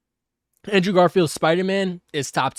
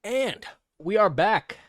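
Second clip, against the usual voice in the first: talking with the hiccups; 5 semitones higher, slower than usual. Real, not acted. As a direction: speak through hiccups, slow and careful. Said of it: "I got a little bit of the, uh, the hiccups today".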